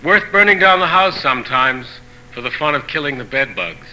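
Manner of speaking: measured proverb recital, each phrase starting high and falling at the end; poetry reading. Acted. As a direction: read each saying in separate phrases, pausing between them. Poet reads translated proverbs aloud.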